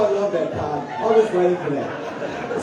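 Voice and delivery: normal talking voice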